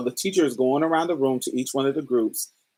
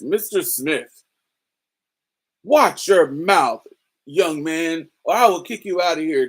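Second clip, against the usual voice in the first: stern teacher; 3 semitones higher, 4 dB louder than usual. Retold or imitated. Teacher warning the joking student, a hint of a stern scold. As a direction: lightly imitate a strict little teacher warning a student.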